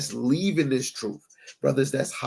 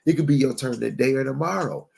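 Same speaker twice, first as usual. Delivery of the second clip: ordinary talk, nothing marked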